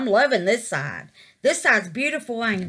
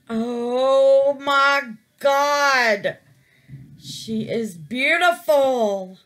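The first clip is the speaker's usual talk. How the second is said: awed exclaim; 3 semitones higher, 5 dB louder, much slower than usual. Real, not acted. Reveal of finished piece.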